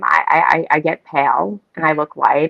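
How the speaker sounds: ordinary delivery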